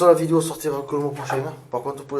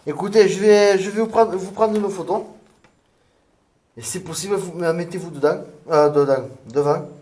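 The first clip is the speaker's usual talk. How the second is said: loud, higher call to the room; 5 semitones higher, 7 dB louder than usual. Real, not acted. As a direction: call out to someone unseen. Calling out to spirits for photo.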